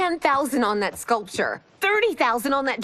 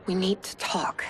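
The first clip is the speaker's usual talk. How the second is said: hushed serious; 7 semitones lower, 4 dB quieter than usual. Real, not acted. Wife breaks bad news.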